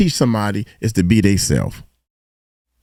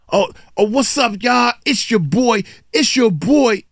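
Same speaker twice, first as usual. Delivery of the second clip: mock YouTuber intro; 9 semitones higher than usual. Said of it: "YouTuber saying whatever the latest trend".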